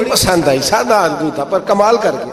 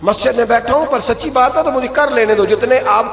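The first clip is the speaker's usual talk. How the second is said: higher, urgent sermon exhortation; fiery preaching; 5 semitones higher than usual. Real, not acted. Preacher warns the faithful, impassioned and high. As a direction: drive the warning home to the congregation.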